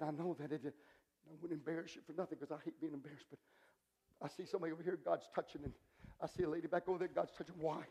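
very low, hushed preacher's voice; quiet, intimate pulpit tone with long pauses; soft altar ministry. Acted. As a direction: minister gently, almost under the breath.

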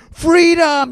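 loud, high rallying shout; Braveheart battle cry. Acted. Moses as movie hero in blue face paint, battle cry. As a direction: cry it out as if to an army. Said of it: "like Braveheart and say".

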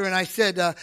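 usual speaking voice

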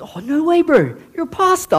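high-pitched astonished Islander, retold; amazed disbelief. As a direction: shoot the pitch up in happy disbelief. Acting the amazed Islander's reply.

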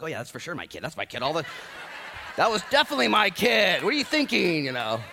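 put-on high, loud, laughing brag about his kid; proud laughing reply. Proud father retelling lifeguard chat.

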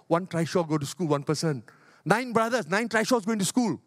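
ordinary talk

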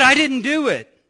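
put-on child's denial again. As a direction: echo her innocent denial.

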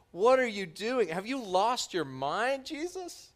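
a rising, shocked "have you lost your mind"; incredulous Peter. Retold or imitated. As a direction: Peter can't believe what Jesus is doing. Peter protesting Jesus washing feet.

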